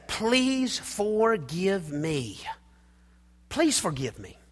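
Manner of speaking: very slow, soft pleading; gentle plea. Shown as a demonstration. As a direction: draw out the plea tenderly. Modeling a pleading apology.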